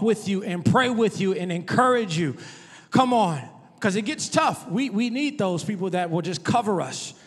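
loud preacher pushing every line; fiery preaching. Acted. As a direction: preach it hard to the congregation. Preaching at full force.

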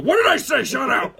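very high, loud mock outburst; mock angry shout. Comic hero silencing questioners, mock angry shout. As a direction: shout them down in jest. Acted hero snapping back.

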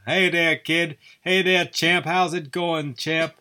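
put-on a first, tentative try at the old boxing announcer; Howard Cosell impression. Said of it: "talking like that other one who was best pals with Muhammad Ali".

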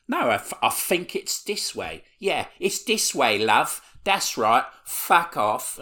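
fake-helpful kids mocking her; mock British accent. Kids sending her the wrong way in a mocking British accent. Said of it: "giving her a hard time".

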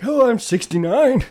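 high mock old-man voice. Teasing the scam caller, mock old man.

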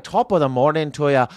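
joking, polite Irish lilt; mock-Irish politeness; Irish accent. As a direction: put on a very courteous Irish accent. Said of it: "I can't talk like that".

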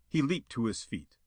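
usual speaking voice

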